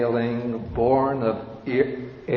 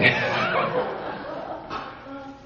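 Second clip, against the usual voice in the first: high-pitched laughing giggle; teacher giggling at his own joke. Real, not acted. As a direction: keep giggling at the slip. Still laughing after correcting himself.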